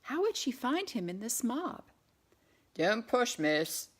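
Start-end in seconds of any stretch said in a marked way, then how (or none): 2.7-3.9 lightly voiced Cockney man, gruff; a hint of a Cockney crowd man grumbling; Cockney growl. A narrator's reading. Cockney man in the crowd warning Claire, light Cockney growl. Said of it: "growled a Cockney voice".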